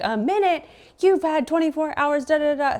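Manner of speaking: incredulous outrage. Retold or imitated. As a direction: throw his words back at him in disbelief. Mocking his request for a minute.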